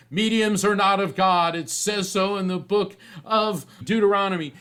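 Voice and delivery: high, loud scripture-quoting scold; preachy churchwoman. Retold or imitated. Older churchwoman condemning the medium, loud prim scripture scold. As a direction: lecture him like a prim church lady.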